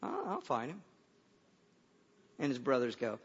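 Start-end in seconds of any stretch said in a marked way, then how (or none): 0.0-0.8 small, hesitant offer. Retold or imitated. David's small reply after army's panic.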